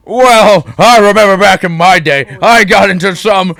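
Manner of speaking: high, loud, slow pompous voice; pompous principal. Acted. Principal greets the boy in his pompous voice. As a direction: purr like a self-satisfied old gent. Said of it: "This voice requires this arm position".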